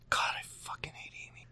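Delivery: very soft, quick whispered curse; seething mock whisper; hateful whisper. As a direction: whisper your loathing under your breath. Muttered mock hatred of Amy.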